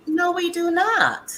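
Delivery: high, drawn-out indignant denial; exasperated, stretched-out objection; emphatic rebuttal. Acted. Rebutting the quoted claim about immigrants.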